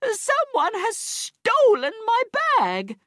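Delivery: very high, slow shriek of outrage; screamed accusation. A narrator's reading. Robbed woman telling the whole beach, shrieking slowly in outrage. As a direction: shriek it, drawing out every word. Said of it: "she screamed".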